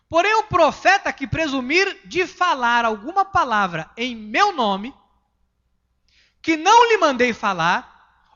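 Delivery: slow, loud, emphatic Bible reading; forceful scripture reading. Acted. Pastor reading Deuteronomy aloud to the church, slow and forceful. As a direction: proclaim each phrase slowly.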